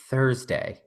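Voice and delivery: soft, low drawn-out Thursday; a hint of Patsy from Ab Fab; Patsy bit. Shown as a demonstration. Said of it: "Romaine doesn't even know what bit I'm doing".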